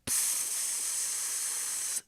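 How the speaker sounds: long, steady leaking-gas hiss; imitated spray hiss. Telling of a can bursting under the seat, drawn-out imitated hiss. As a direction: hiss through the teeth like escaping spray. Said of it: "we start hearing".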